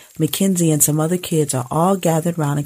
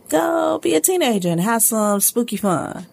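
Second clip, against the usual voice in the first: corny cheerful mom; 7 semitones higher than usual. Acted. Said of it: "Nobody talks like that".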